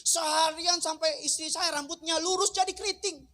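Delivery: high, loud, rushed account of a chaotic day; frantic retelling. Retold or imitated. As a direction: whip up the household chaos. Wife's chaotic day retold. (menceritakan kekacauan dengan heboh dan keras)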